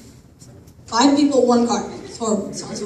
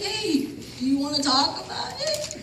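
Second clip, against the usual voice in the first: higher, slow and gentle, coaxing; gentle invitation; 7 semitones higher than usual. Retold or imitated. Husband gently asking to talk.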